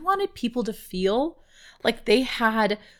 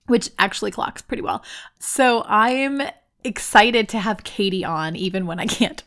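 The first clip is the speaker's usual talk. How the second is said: ordinary talk, nothing marked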